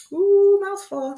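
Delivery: high, loud, drawn-out "ooh"; playful exclamation. Real, not acted. Teacher reacting to a long new word, loud playful exclamation. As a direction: exclaim at what a tongue twister it is.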